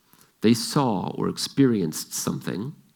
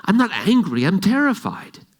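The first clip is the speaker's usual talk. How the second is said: father's voice; 11 semitones higher, 6 dB louder than usual. Retold or imitated. Father voiced answering daughter.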